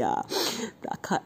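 usual speaking voice

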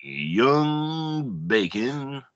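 very slow, high, drawn-out delivery; hammed-up slow questioning; silly put-on character. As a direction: stretch each word in a goofy voice. Silly role-play bit between hosts.

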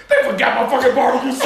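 fast, hammed-up angry shout; mock furious yell about the missing sauce; imitating an angry friend. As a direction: rant like the friend who got a plain sandwich. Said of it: "He said".

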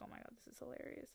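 quick, whispered aside of delight; amused whisper. Retold or imitated. Recalling her reaction to the threat, a fast amused whisper. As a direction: whisper it fast like a private joke. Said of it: "I was like".

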